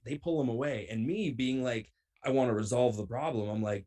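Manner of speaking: ordinary talk, nothing marked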